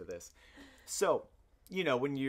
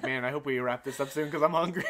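laughing complaint; 3 semitones higher, 9 dB louder than usual. Real, not acted. Joking about starving during the rant.

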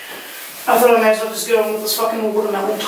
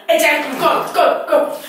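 ordinary talk

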